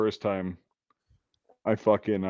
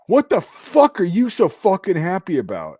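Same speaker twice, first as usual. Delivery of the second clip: loud, high-pitched chewing-out; barked, outraged demand; angry drill sergeant; 9 semitones higher, 8 dB louder than usual. Retold or imitated. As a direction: imitate a sergeant tearing into a recruit. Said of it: "my drill sergeant".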